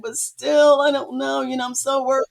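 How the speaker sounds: high-pitched imitation of tearful worry; retold crying. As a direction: plead as if crying, pitched well up. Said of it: "I'm, like, crying".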